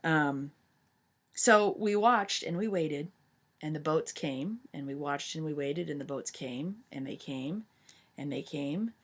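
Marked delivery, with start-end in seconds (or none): 4.7-8.9 hushed suspense. A narrator's reading. Teacher telling the class how the ships kept coming, hushed and fading. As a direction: let it fade as the ships close in.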